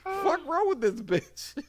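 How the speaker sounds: high, slowed disbelieving squeal; mock shocked outrage. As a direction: gasp it out in shock. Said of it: "I said".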